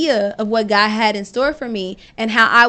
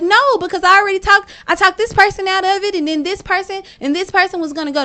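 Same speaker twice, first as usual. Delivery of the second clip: urgent protest; 6 semitones higher than usual. Retold or imitated. Wife insisting to husband that people depend on her, high and urgent. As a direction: argue fast that they really need you.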